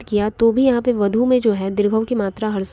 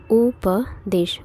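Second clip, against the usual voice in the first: उपदेश stretched very slowly, syllable by syllable; drawn-out word; much slower than usual. Shown as a demonstration. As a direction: draw out उपदेश slowly for the class. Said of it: "teacher spells out the word". (उपदेश को धीरे-धीरे खींचकर बोलना)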